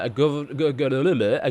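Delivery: stumbling over the words; real flustered stammer on a repeated word; tongue-tied. Real, not acted. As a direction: trip over your tongue and restart. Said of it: "I forget how to talk".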